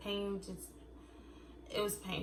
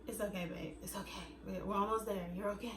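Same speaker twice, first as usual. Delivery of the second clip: soothing husband; 8 dB quieter, faster than usual. Retold or imitated. Husband voiced soothing crying wife.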